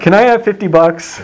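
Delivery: put-on high, wheedling kid asking to go out; teen wheedling dad for permission; pleading teen.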